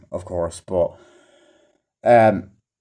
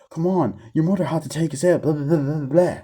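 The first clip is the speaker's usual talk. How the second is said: higher-pitched mocking recital, ending in blahs; mock film dialogue; 6 semitones higher than usual. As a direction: parody a character nagging, voice lifted.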